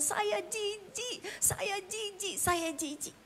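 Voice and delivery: high, slow, drawn-out lament; anguished self-disgust. Retold or imitated. Husband about to cut himself, disgusted with himself, acted lament.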